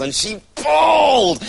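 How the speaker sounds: the final heaving pull, straining; strained shout. A narrator's reading. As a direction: shout pulled like a hard heave. Third pull, climax of repetition.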